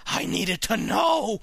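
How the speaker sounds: slow, high rise on "know"; anguished insistence. A narrator's reading. Narrator's desperate resolve.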